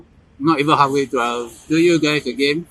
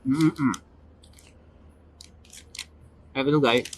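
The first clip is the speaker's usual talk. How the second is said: talking with mouth full; words through a bite of kebab; chewing noisily. Real, not acted. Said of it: "Sorry if I talk with my mouth full".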